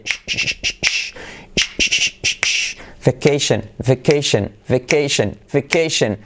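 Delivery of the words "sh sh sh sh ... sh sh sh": repeated unvoiced hushing bursts; SH demo. Shown as a demonstration. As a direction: drill "sh" rhythmically without voice. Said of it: "Letra clave, S H".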